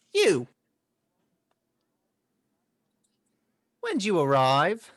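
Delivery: loud, high birdman voice; hooded Tengu. Acted. Game master voices hooded Tengu.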